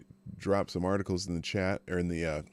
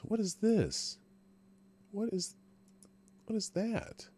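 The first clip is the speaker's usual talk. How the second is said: puzzled; 13 semitones higher, 6 dB quieter, slower than usual. Real, not acted. Puzzled at the screen.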